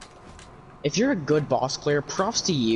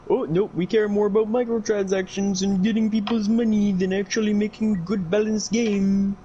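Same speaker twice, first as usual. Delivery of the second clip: high, mocking Indian accent; mock a company rep in an Indian accent; fake Indian accent; 8 semitones higher than usual. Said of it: "a fake Indian accent for that".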